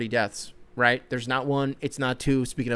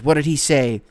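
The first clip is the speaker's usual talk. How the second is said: ordinary talk, nothing marked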